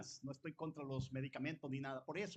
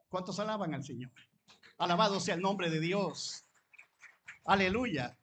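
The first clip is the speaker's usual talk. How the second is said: louder preaching proclamation; call to praise; 2 semitones higher, 8 dB louder than usual. Acted. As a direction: lift the congregation into praise. Pastor calls church to praise. (proclamación de alabanza, más fuerte)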